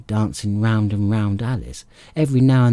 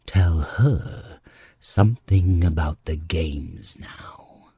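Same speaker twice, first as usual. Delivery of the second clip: hushed whispered creature voice; Gryphon's whisper; 4 semitones lower, slower than usual. Acted. Gryphon whispering to the Mock Turtle, hushed whisper. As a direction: breathe it out as a low whisper.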